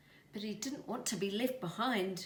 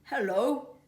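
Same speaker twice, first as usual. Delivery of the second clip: insect voice; 7 semitones higher, 9 dB louder than usual. A narrator's reading. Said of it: "one of them said through a mouthful of leaf".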